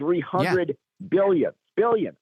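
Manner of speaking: "billion" slowed and stressed. Real, not acted. Guest underlining the size of the fund, stress on "billion". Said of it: "I said billion dollars".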